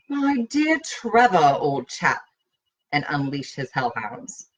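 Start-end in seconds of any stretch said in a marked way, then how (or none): 0.1-2.2 mild mock upper-class English accent, slow; haw-haw posh accent. Blackie mocking T's class in a haw-haw English accent. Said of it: "with a haw-haw accent".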